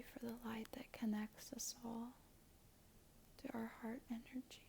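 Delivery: very soft, hushed thanks; near-whispered prayer. Real, not acted. Thanking Spirit for healing and strength before the reading, near-whisper. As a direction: murmur a reverent prayer, almost whispering. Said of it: "I feel like I'm whispering".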